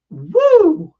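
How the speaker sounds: a high, loud whoop of delight; joyful high shout; excited whoop. Pretend. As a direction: cheer like the crowd loved it. Cheer after imagined praise.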